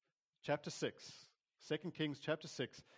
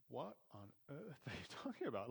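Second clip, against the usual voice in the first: bewildered; 6 dB quieter than usual. Retold or imitated. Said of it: "this servant had to be going like".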